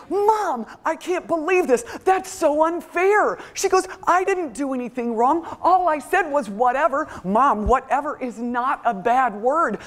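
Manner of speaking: put-on high-pitched indignant teen protest; whining teenager. Teen daughter losing her phone to mom, whining indignantly. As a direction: imitate an outraged teen pleading unfairness.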